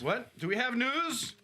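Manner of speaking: high squeaky what, do we have news; hammed-up surprised squeal; mock surprise.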